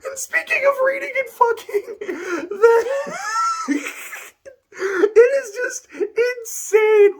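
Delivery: real laughing fit, high and loud, words stretched slow; howling laughter. Real, not acted. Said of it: "I was howling for, like, twenty straight minutes".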